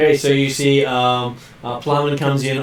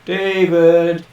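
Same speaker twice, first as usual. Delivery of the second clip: calling out; 5 semitones higher, 4 dB louder than usual. Real, not acted. Calling a dropped player.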